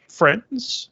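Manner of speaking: high, light woman's voice; a bird mimicking a lady, lightly; light female voice. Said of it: "in a light female voice".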